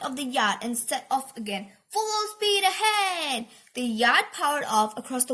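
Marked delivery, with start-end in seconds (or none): 1.9-3.5 high, drawn-out shout; rousing captain's order; commanding call. A narrator's reading. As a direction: call it across the deck. Minnie calls out a ship's order.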